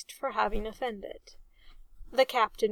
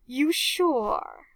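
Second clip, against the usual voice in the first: excited; 4 semitones higher, 5 dB louder than usual. Acted. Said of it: "Reg fairly leapt into the air".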